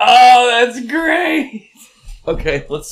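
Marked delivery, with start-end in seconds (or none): 0.1-1.7 much higher, louder, slow drawn-out delight; delighted exclaim. Real, not acted. Cracking up at a fake commercial break, delighted and loud. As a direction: relish the joke out loud.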